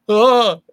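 high, loud wordless "oh"; mock panicked groan. Actor reenacts being rushed into American, mock panic. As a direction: blurt a high, loud sound of mock helplessness.

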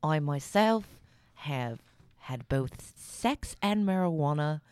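a light, mostly whispered mock Michael Caine accent; Michael Caine impression. Co-host joins the Michael Caine bit in a mock Michael Caine accent. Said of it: "let's talk like Michael Caine".

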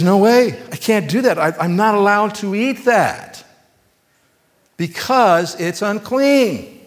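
high-pitched mock protest; protesting refusal. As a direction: play Peter digging his heels in, pitched well up. Acting out Peter's refusal to God.

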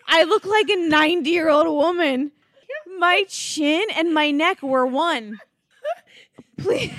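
loud, high, mock-despairing wail; loud dismay. Real, not acted. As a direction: wail it at the absurd photo. Describes own swollen neck.

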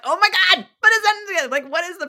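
high, loud retold exasperation; exasperated outburst. Model retelling her tongue-tied Paris moment, exasperated outburst. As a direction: burst out in mock frustration at yourself.

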